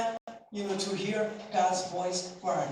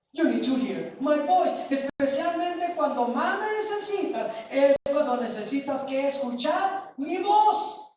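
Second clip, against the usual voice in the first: higher and louder preacher's cry, building; fervent preaching; 5 semitones higher, 4 dB louder than usual. Acted. Pastor voicing God to the congregation, fervent preaching. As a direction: preach it out with rising fire, in God's voice. Preaching God's words at full force.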